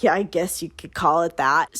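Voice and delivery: low, mock-smirking man's reply. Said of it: "he's smirking".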